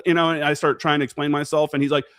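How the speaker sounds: ordinary talk, nothing marked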